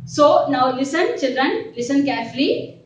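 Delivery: ordinary delivery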